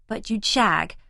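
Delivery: ordinary delivery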